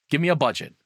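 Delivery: ordinary talk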